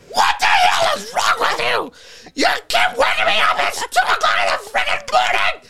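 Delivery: very high, screeched scolding; hammed-up shrieking rant; imitated furious screaming. Serena yelling at the man who woke her, screeched.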